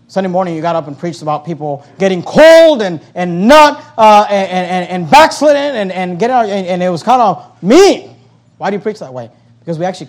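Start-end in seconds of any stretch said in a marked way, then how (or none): 0.1-8.1 loud mock complaint; complaining congregant. Pastor voicing a member's complaint, loud mock whine. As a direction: whine like a hurt church member. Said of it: "You say".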